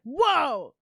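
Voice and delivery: loud, high whoa that swoops down; astonished exclamation. Retold or imitated. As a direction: burst out as if taken aback. Retelling startled reaction to natural accent.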